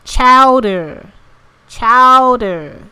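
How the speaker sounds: "Chowder" loud and drawn out with a hard final r; big mock-American "Chowder"; chowder pronunciation demo. Shown as a demonstration. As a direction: copy a pronunciation clip's "Chowder", overdoing it.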